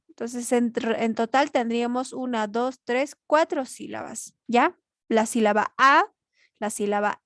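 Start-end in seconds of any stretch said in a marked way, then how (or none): none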